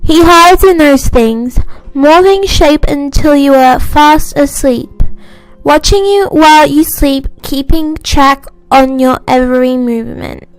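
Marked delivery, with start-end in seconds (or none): none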